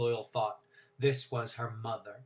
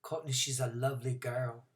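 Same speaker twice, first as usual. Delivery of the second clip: timid grandmother; 2 semitones lower, 6 dB quieter than usual. Acted. Nani voiced, timid.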